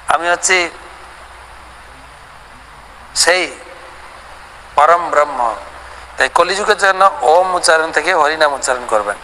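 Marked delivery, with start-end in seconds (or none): none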